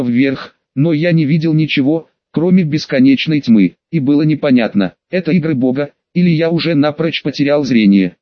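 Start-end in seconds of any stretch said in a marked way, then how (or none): none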